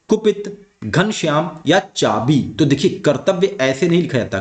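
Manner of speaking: ordinary talk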